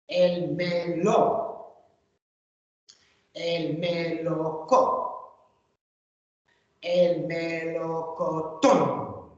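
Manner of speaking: slow build-up of "El melocotón"; syllable by syllable. Shown as a demonstration. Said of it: "Did you hear what I did at the end?".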